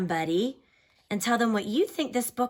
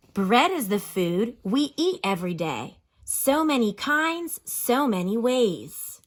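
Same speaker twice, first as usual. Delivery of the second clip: slow, sing-song reading of a rhyming picture book, lifted and louder on the list; expressive read-aloud; 4 semitones higher, 4 dB louder, slower than usual. Acted. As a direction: read each bread type like a little poem to the class. Teacher reads rhyming book aloud.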